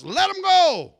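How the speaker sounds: delivered man yelling at the departing demons; yelled out. Retold or imitated. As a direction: shout it out at the top of your voice. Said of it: "that's what he yells out to us".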